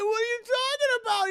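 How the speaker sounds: put-on very high, frightened boy voice; hammed-up high terror; acted panic. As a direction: squeak out a panicked denial. Terrified boy at knifepoint.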